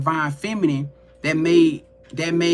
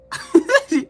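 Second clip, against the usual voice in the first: amused, high-pitched burst of laughter; 11 semitones higher, 5 dB louder than usual. Real, not acted. Vlogger amused by her own shock, high laugh.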